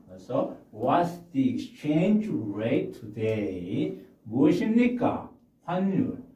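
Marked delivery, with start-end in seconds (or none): 0.7-4.0 slow and deliberate, stress on exchange; walk through it word by word; slow demo. Shown as a demonstration. Teacher repeating the phrase slowly to explain the. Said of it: "모음 앞에는 더를 갖다가".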